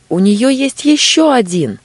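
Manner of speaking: high loud shout of alarm; panicked scream. Acted. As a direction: scream out a warning. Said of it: "закричала Женя". (испуганный крик)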